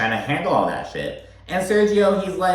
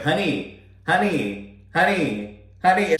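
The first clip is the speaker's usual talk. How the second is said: high, needy repeated calling; whiny pestering husband; 6 semitones higher than usual. Retold or imitated. Husband pestering Stanberry during move, whiny repeated calling. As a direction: nag for attention like a pest. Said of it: "Or he's like".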